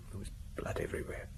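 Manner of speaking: whispered, breath catching on the horror; hushed whisper. Acted. Telling the inspector about the body, in a shaken whisper. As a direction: barely voice it, shaken by the memory. Recalling finding the bloody body.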